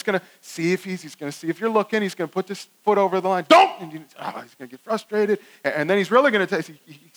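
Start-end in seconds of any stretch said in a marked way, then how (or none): 3.5-3.8 high, loud Don't!; loud parental shout of Don't!; sharp warning shout. Acted. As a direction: snap it at the child like a parent.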